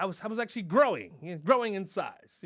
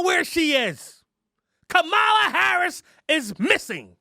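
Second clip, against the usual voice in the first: mock furious rant; 9 semitones higher, 10 dB louder than usual. Comic ranting at Biden about Harris, mock furious shouting. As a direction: rage at the president like a protester.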